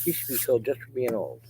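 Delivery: high mocking whine of a pretty critic. Mimicking viewers who call him old, a mocking high voice. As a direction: sneer it in a prissy high voice. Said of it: "That's what they're saying".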